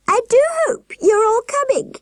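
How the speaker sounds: very high warbling voice from a giant woman; very high, trilling sweet voice; warbling falsetto nurse. A narrator's reading. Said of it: "she warbled".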